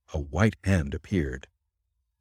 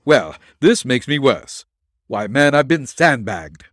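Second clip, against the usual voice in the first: raised, louder indignant retort; exasperated protest; 5 semitones higher, 6 dB louder than usual. A narrator's reading. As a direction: protest emphatically, higher and louder. Nick protests the doctor's diagnosis.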